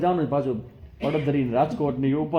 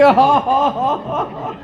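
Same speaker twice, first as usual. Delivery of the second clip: loud high ha-ha laughing; imitated laughter; 7 semitones higher, 7 dB louder, much slower than usual. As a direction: laugh like the laughter-club guy. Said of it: "તો કે". (મોટેથી ઊંચા સૂરે હા હા હાસ્ય)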